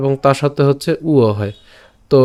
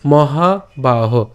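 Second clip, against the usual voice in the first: মহাবাহ stretched very slowly as mo-ha-ba-ho; drawn-out word; much slower than usual. Shown as a demonstration. Teacher slowly modelling মহাবাহ for learners. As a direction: draw out মহাবাহ syllable by syllable. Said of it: "উচ্চারণটা কেমন হচ্ছে?".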